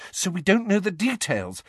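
high-pitched, uneasy admission; nervous. Acted. Hermione admits ignorance to Harry, nervous. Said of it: "said Hermione nervously".

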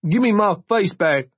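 put-on high, desperate drunken begging; acted tearful demand; sobbing plea. A narrator's reading. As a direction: beg tearfully, pitch way up. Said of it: "Jory sobbed".

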